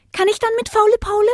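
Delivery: put-on very high, quick child-like voice; high, loud, fast little question; little puppy. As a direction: pester Papa like a tiny pup. Pup asks again.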